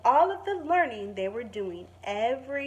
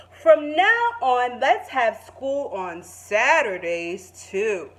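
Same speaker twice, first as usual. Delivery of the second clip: slow, loud, grand principal voice; Mister King announcing; 8 dB louder, slower than usual. Acted. Principal Mister King addresses all the students, slow and grand. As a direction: proclaim it proudly and slowly. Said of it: "and said".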